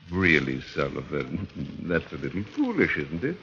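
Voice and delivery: soft, amused, menacing undertone; quiet menace. Acted. Doctor quietly mocking his captive with a soft laugh. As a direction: let the threat sit under a low chuckle. Vance mocks hope of release.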